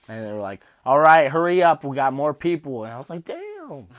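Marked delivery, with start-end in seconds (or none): 0.8-2.8 loud, higher-pitched rushing order; impatient staff. Retold or imitated. Staff hurrying a patient along with others waiting, retold loud and impatient. 3.2-3.9 very high squeaked exclamation; taken aback. Retold or imitated. Patient reacting to being rushed by staff, high mock dismay. Shocked reply to rushing staff.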